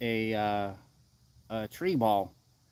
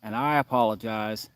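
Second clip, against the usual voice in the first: ordinary talk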